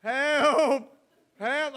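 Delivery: high, loud laughing cry for help; mock call for help. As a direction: holler for rescue while laughing. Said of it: "so I had to go".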